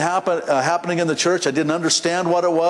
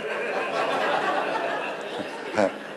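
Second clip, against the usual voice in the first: quiet breathy laugh; 7 semitones lower, 7 dB quieter than usual. Real, not acted. Laughing about the tacos.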